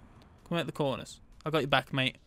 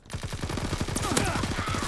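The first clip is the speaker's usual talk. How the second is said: breathy laugh; 3 dB quieter than usual. Real, not acted. Laughs at failed trick.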